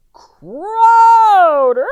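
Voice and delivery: long, very high, loud crowing sound; triumphant crow. A narrator's reading. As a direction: let the word ring out like a rooster. The loudest crow ever.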